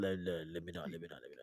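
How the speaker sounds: very low and soft, backing off; muttered inner thought. Retold or imitated. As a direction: trail down into a tiny self-check. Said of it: "but I thought".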